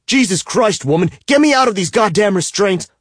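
high, loud furious shout; angry yell. A narrator's reading. Bound man to his captor, furious shout. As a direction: yell to be untied, fighting the ties.

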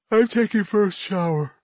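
high, stretched yawn. Acted. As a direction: say it through a yawn. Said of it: "she says with a yawn".

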